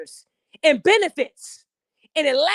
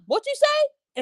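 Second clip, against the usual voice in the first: much higher offended snap back; stunned, indignant woman; 13 semitones higher, much slower than usual. Retold or imitated. Mocking a woman who was just told to shut up, high and offended. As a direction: bristle as if you can't believe your ears.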